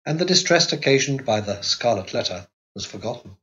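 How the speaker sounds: ordinary delivery, nothing marked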